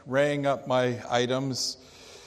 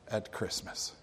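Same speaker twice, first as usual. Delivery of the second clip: hushed; 5 semitones lower, 11 dB quieter than usual. Acted. Sermon point, dropped to a hush.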